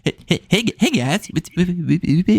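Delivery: exaggerated stutter impression; Jimmy. Shown as a demonstration. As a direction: stammer hard, stuck repeating each word. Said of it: "do a good Jimmy impression".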